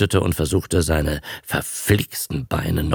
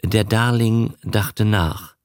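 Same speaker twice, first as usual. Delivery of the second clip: ordinary delivery, nothing marked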